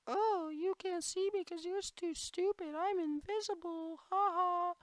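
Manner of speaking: squeaky mocking high voice; high taunting voice. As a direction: tease them in a high silly voice.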